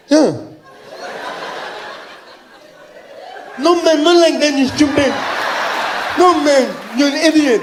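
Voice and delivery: high, loud deaf man's voice; exasperated deaf fan. Acted. Deaf fan scolds the slow comedian, high loud exasperation. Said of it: "he was partially deaf".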